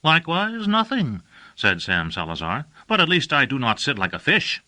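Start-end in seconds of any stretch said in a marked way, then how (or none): 0.0-1.2 put-on higher, earnest young apprentice; bright-eyed apprentice, a bit higher and louder; Sam Salazar's voice. A narrator's reading. Sam's reply. 2.9-4.6 put-on higher, earnest young apprentice; bright-eyed apprentice, a bit higher and louder; Sam Salazar's voice. A narrator's reading. Sam's retort.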